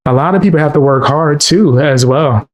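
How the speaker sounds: ordinary talk, nothing marked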